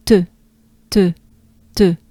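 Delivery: "te" short with rounded French e; French e sound. Shown as a demonstration. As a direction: model clipped French "te". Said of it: "It's forward".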